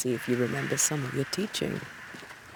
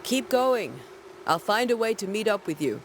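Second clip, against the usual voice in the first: urgent call; 7 semitones higher than usual. Acted. Urging partner on during fight.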